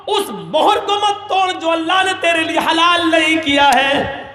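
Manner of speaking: high, intense sermon cry; impassioned preaching. Acted. Preacher voices the repentant cousin pleading with his sister. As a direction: plead with rising fervour.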